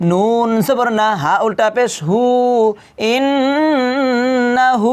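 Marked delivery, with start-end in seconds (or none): 3.1-4.9 ইন্নাহু with the নুন held nasal and drawn out; stretched ghunna. Shown as a demonstration. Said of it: "এক আলিফ টেনে পড়তে হবে". (ইন্নাহু গুন্নাসহ টেনে)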